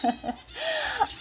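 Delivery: high delighted giggle; a quick happy giggle; giggling at the perfect gifts. Real, not acted. Laugh over the gifts.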